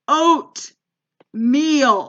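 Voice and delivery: retold insistent customer, loud and slow; emphatic demand. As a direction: break the word in two and push each half hard. Mom insists to confused clerk.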